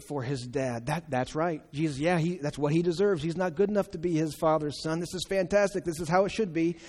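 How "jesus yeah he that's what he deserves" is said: ordinary talk, nothing marked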